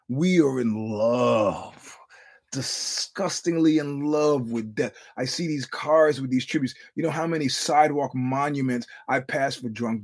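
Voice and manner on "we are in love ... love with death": slow, crying words about loving death; real grief, voice breaking and slow. Real, not acted. Grief over neighborhood deaths.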